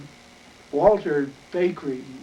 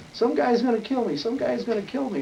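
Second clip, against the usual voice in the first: panicked plea; 7 semitones higher than usual. Retold or imitated. Boy fleeing a knife-wielding man.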